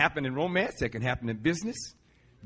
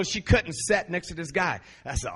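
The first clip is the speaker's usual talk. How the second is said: ordinary delivery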